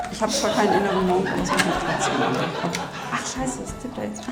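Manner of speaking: ordinary delivery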